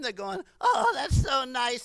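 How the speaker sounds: ordinary talk, nothing marked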